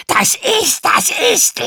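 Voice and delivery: high, loud spiteful mocking; venomous snarl. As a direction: spit the words back venomously. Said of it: "keifte der Drache giftig".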